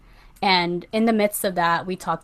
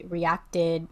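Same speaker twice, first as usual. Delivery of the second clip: ordinary delivery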